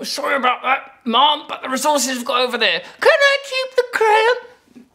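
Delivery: high put-on pleading voice; high, wheedling mock apology; apologetic pleader. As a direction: climb to a silly squeal on the request. Acts out apologetic request for resources.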